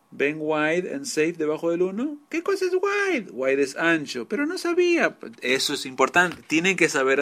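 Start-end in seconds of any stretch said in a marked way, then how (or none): 2.3-3.3 high squeaky mimicry of a puzzled student; imitating a clueless pupil, pitched way up; mock student question. 4.3-5.2 high squeaky mimicry of a pupil's excuse; imitating a student, pitched way up; mock student excuse.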